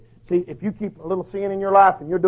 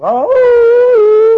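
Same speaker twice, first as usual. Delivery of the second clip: high, loud mock wolf howl "Oh!"; 12 dB louder than usual. Preacher imitates a wolf howl for the congregation, loud and high. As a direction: throw your head back and howl like a wolf. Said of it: "And one of them will go".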